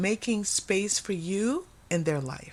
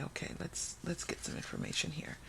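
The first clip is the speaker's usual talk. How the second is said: breathy whisper to herself, much softer; whispered push; 2 semitones lower, 13 dB quieter than usual. Retold or imitated. Tarot reader nudging her cards for detail, whispering. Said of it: "I like to push it and say".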